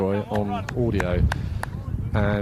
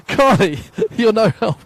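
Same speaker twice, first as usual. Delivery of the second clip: laughing exasperation; 10 semitones higher than usual. Real, not acted. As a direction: tease a colleague while cracking up. Laughing at co-worker's confusing answer.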